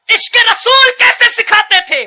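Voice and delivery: high, loud fiery sermon; preaching at full force. Acted. Preacher urging parents, roaring high and loud. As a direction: build the crowd with a roar.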